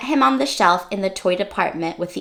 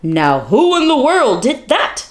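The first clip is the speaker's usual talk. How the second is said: louder exclaiming night watchman; exclaimed; 5 dB louder than usual. Acted. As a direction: call out like a puzzled guard. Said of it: "he exclaimed".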